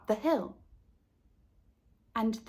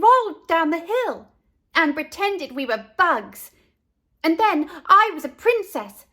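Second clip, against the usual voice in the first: put-on excited child voice; 6 semitones higher, 6 dB louder than usual.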